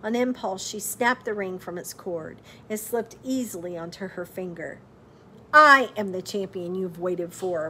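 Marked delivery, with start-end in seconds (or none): 5.5-7.7 louder, proud declaration; cried out. A narrator's reading. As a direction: announce it boldly. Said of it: "Margaret cried".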